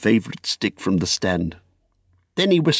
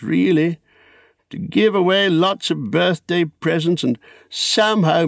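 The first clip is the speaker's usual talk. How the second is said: higher-pitched hobbit explaining himself; Bilbo; 7 semitones higher than usual. Acted.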